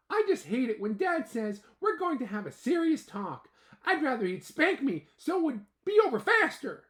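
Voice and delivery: put-on high, loud small ant kid, relieved; ant boy voice. Ant boy telling his dad about the rescue, high loud voice.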